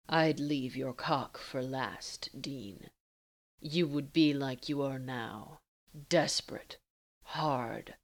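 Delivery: low and unhurried, taunting a lover; teasing Castiel. Acted.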